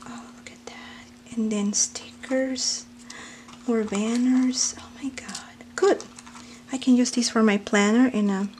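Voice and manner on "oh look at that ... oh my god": hushed breathy whisper of wonder; awed whisper. Real, not acted. Unboxing pretty flowers, she whispers in awe. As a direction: whisper as if afraid to break it.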